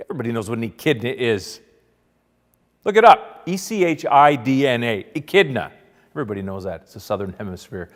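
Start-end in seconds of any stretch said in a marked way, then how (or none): 2.8-5.7 loud, high opening command, then firm spelling; mock-indignant know-it-all; emphatic insistence. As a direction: spell it out like it's obvious. Insisting on an obscure word.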